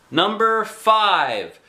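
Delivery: drawn-out loud announcing call. A narrator's reading. Cake lady announcing the winning number, drawn out. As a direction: call the number slowly across the room. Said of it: "she said very loud".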